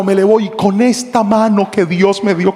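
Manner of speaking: usual speaking voice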